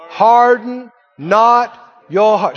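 slow, loud, every word weighted; slow emphatic recitation. Shown as a demonstration. Preacher leading the verse: slow, loud, drawn out. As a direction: lead the church through the verse slowly. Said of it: "Say it again".

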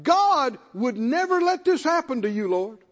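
a loyal disciple insisting; earnest Peter protesting. Retold or imitated. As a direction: plead that God won't let it happen. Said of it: "And he said".

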